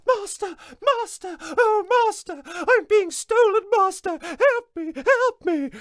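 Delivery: very high, loud shrill cry for master; harp crying for help. Acted. Magic harp calling its master while stolen, high squeal. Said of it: "when the harp said".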